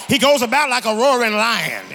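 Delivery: loud, emphatic sermon build; preacher in full cry; full-force preaching. Acted. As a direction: drive each point out loud to the congregation.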